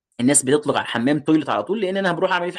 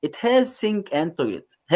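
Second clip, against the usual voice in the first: slow and high, word by word; very slow dictation pace; very slow reading; 6 semitones higher, much slower than usual. Shown as a demonstration. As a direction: read It has sink slowly, pitched up. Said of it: "slow reading for class".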